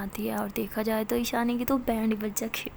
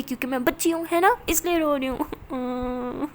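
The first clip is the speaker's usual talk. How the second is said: tearful sulk; 2 semitones higher, 4 dB louder than usual. Acted. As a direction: sob it out like a hurt child. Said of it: "तो परी ऐसे एक रोने लग जाती है". (रोते हुए धीमी रुआँसी आवाज़)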